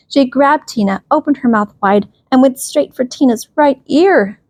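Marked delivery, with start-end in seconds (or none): none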